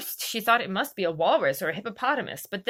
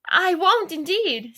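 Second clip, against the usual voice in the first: higher, louder, flustered promise; Alice hastily promising the mouse; hurried reassurance; 8 semitones higher, 7 dB louder, slower than usual. A narrator's reading. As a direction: rush to calm things down. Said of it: "said Alice, in a great hurry".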